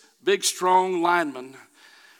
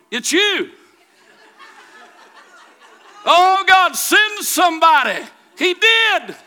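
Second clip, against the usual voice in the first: raised, high preaching that builds to a shout; full-force preaching; 7 semitones higher, 6 dB louder than usual. Real, not acted. Preacher urging whiners to see God sends somebody, building fervor. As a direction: exhort the congregation, climbing to a loud, high cry.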